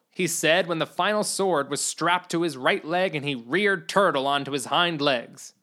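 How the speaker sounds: ordinary delivery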